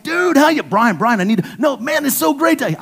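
high, loud, tumbling excited greeting; excited rush. Retold or imitated. Preacher reliving his overexcited phone call to a lost friend. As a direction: gush over an old friend found again. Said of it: "I wouldn't shut up. I was so excited".

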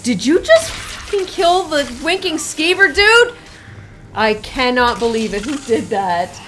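loud, high outraged shouting; outraged disbelief. Real, not acted. Streamer yelling at a viewer who killed the innkeeper, outraged. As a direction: cry out in shocked disbelief at the kill. Reacting to tavern keeper killed.

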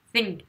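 "Think" with a soft voiceless th; tongue between teeth. Shown as a demonstration. Teacher showing French learners the English th, "Think" lisped on the tongue tip. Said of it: "T H entre les dents".

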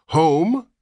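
high, loud disbelieving question; can't believe what he heard; incredulous. A narrator's reading. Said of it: "repeated Mister Button incredulously".

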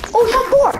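high, loud, thrilled cry; excited. Real, not acted. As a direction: blurt it out, genuinely thrilled. Reaching top four.

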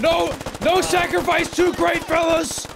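loud, high mock-heroic cries; hammed-up heroic shout. Player charging into battle as a doomed hero, loud hammed-up shouting.

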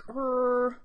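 "krr" held as one drawn-out blend; sounding out. Shown as a demonstration. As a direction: stretch "krr" like a reader stuck mid-word. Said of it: "when they have to think through".

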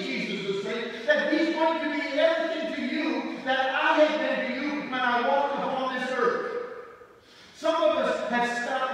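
loud, high-pitched sermon delivery; full-force preaching. Real, not acted. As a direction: preach it out with rising intensity.

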